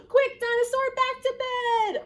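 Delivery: loud, high, alarmed cry; urgent warning. A narrator's reading. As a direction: urge him to run before he's caught. Urges Dinosaur to flee.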